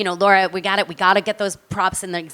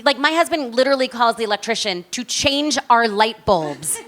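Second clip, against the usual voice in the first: usual speaking voice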